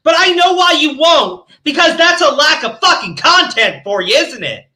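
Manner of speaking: furious accusatory shouting; angry shout. Real, not acted. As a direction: shout them down. Shouting at channels.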